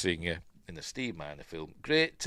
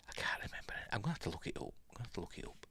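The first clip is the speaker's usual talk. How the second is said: near-whisper, much softer aside; muttering to self; 14 dB quieter, much faster than usual. Real, not acted. Host stuck on an actor's name, muttering to himself. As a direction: think aloud under your breath.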